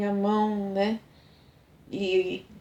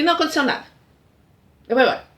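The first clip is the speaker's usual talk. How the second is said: louder, higher curt refusal; curt brush-off; 4 semitones higher, 6 dB louder, much faster than usual. Retold or imitated. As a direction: cut her off and walk out. Mário storms off angrily.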